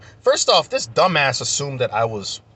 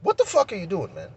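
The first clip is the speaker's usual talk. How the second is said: exasperated challenge; 7 semitones higher than usual. Retold or imitated. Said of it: "I'm like".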